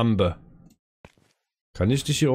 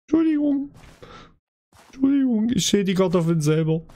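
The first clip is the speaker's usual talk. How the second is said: scared horror game streamer; 9 semitones higher than usual. Real, not acted. Apologizing to the scary doll.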